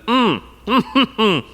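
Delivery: chewing, humming through food; mouth full. Real, not acted. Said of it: "Why is he talking with his mouth full?".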